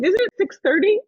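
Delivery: high, loud imitated surprise; acted-out incredulous question; mock disbelief. Friend's surprise at the start time.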